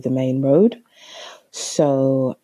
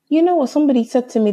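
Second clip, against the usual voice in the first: excited; 12 semitones higher, 4 dB louder, much faster than usual. Real, not acted. Sudden excited realisation.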